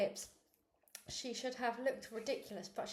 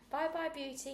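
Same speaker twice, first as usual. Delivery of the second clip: calling out; 6 semitones higher than usual. A narrator's reading. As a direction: call across from the car window. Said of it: "he called".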